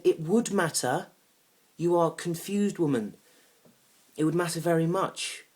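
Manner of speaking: slow, loud, high-pitched emphasis; exasperated insistence. Acted. Man correcting his date in an argument, exasperated. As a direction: hammer out each word in exasperation.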